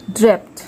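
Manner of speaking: ड्रैप्ड finishing on "pt"; final D as T. Shown as a demonstration. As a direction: end ड्रैप्ड with a crisp t, not d. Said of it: "डी का sound ट आएगा". (ड्रैप्ड को ड्रेप्ट बोलकर)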